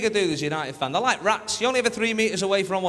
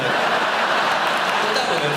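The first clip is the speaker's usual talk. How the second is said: put-on louder, slow Liverpool accent; mock Scouse; 7 dB louder, much slower than usual. Mocking the Scouse bloke, slow loud Liverpool accent. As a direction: drag it out like a Scouser.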